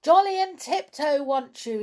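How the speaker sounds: high, loud holler; shouting back. A narrator's reading. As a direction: shout a reply across the wood. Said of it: "shouted back the two dolls".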